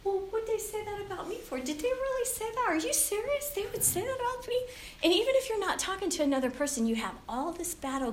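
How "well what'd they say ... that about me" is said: indignant disbelief. Retold or imitated. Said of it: "And I think".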